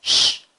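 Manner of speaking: a long loud hissed "Sh"; loud drawn-out sh; sh sound. Shown as a demonstration. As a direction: push the "Sh" out hard. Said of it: "yo se los voy a decir".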